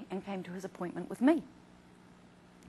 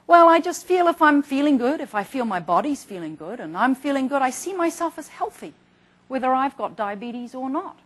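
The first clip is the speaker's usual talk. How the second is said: quoting a patient; 5 semitones higher, 12 dB louder than usual. Retold or imitated. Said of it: "some of the comments".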